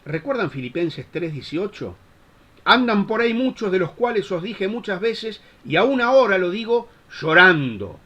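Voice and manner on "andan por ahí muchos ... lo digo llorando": loud, raised declamation of the verse; emphatic scripture quote. Retold or imitated. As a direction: proclaim Paul's words with force. Reciting Paul's verse emphatically to congregation.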